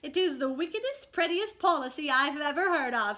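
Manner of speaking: high, loud gushing admiration; admiring cry. Acted. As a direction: gush over the captain's plan. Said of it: "he cried".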